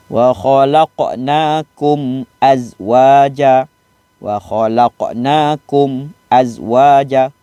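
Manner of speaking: tajwid recitation, mim said clearly before alif; slow Quran recitation. Shown as a demonstration. Ustaz demonstrating clear mim to pupils, slow recitation.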